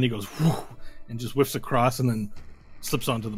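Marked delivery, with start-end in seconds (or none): 0.4-0.5 airy whoosh of a missed grab; imitated whoosh. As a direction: make the sound of a hand whiffing past. Mimes a hand whiffing past.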